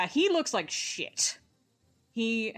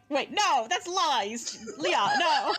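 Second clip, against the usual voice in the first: laughing protest; 7 semitones higher, slower than usual. Retold or imitated. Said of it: "I'm like".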